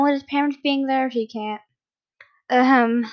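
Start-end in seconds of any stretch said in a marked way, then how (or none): 2.5-3.1 loud, pointed throat-clearing; attention-getting cough. A narrator's reading. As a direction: cut in with a loud ahem. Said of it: "interrupted the younger blonde with a cough".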